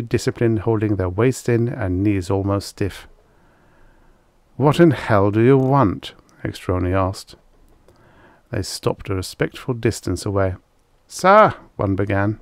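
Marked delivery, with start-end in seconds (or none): 4.6-6.1 louder, higher, irritated challenge; irritated demand. A narrator's reading. Commander curtly challenges arriving officers. 11.1-11.5 high, loud military address; military officer. A narrator's reading. Officer addresses his feared commander.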